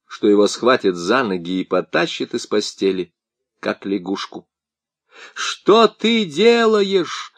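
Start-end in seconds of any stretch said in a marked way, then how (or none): 5.3-7.3 high, slow outraged yell. A narrator's reading. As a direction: yell at the officer. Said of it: "заорал он на офицера".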